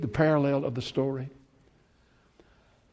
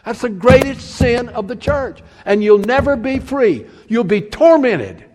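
loud, higher, driving sermon voice; preaching at full force; 5 semitones higher, 6 dB louder than usual. Acted. Preacher warning the church about bitterness, loud and driving. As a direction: hammer the warning home to the church.

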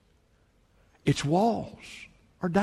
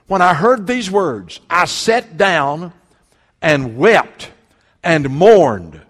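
loud, slow, weighty reading; preaching the verse with force; emphatic scripture reading; 3 semitones higher, 9 dB louder, slower than usual. Acted. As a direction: lean hard into each word. Reading Nehemiah's weeping, emphatic.

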